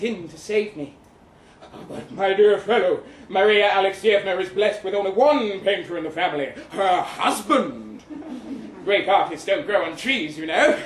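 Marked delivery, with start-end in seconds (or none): 1.9-10.8 louder, laughing through the words; jovial laughing doctor. Acted. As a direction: chuckle it out like a genial doctor smoothing over a gaffe. Doctor jokes to rescue narrator.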